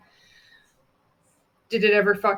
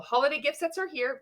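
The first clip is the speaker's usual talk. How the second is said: 1 upbeat promo read; 7 semitones higher than usual. Shown as a demonstration. Reads a sample story post.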